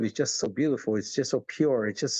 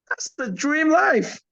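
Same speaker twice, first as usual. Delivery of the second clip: dreamy exclaim; 5 dB louder, much slower than usual. Real, not acted. Dreamy climax of the vision.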